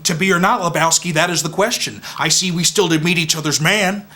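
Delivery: high, insistent theatrical harangue; heated rant. Acted. Knight urging his friend to seek amends for the rug, heated.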